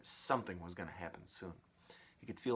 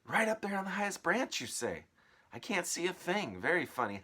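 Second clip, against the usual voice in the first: higher, louder mocking aunt; scoffing aunt; 5 semitones higher, 10 dB louder than usual. Aunt mocking then seeing the peach, loud. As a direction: sneer then gasp in surprise.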